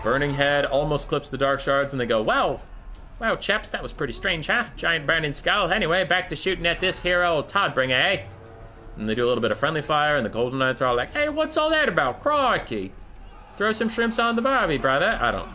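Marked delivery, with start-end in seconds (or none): 2.2-8.2 higher-pitched, lazily attempted Australian accent; mock Australian accent. Dark Elves chatting about the burning skull, mock Australian accent. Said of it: "I think Dark Elves are Australian". 11.1-15.2 high-pitched, lazily faked Australian accent; lazy Australian accent. Elves reacting to friendly fire, lazy Australian accent. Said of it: "lazily attempted Australian accent".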